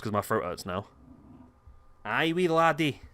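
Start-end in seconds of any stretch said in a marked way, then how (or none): 2.0-3.0 put-on Scottish promo voice; Scottish accent. Announcing a wee Extreme Rules match, in Scottish accent. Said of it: "Scottish promos".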